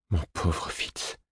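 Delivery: slow, breathed pity; tender whisper. Acted. Woman whispers over her lover's scars, tender. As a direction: whisper it softly, full of pity.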